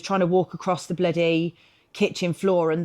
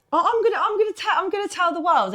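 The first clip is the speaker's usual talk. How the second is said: much higher, laughing through the words; laughing giddily; 13 semitones higher than usual. Real, not acted. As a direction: giggle as you own up. Nervous confession to listeners.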